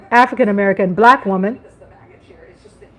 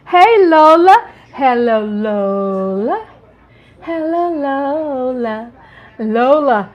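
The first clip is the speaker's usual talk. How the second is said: cooing to cat; much slower than usual. Real, not acted. Calling to her cat.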